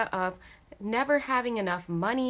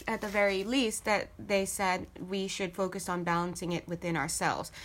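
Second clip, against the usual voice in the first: usual speaking voice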